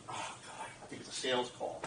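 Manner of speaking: soft, breathless whispered thought; hushed inner groan; whispered aside. Retold or imitated. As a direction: mutter it to yourself.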